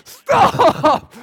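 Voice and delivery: put-on sudden high yell at the toddler. Dad yelling at his toddler on the plane, shouting high. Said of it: "And you're like".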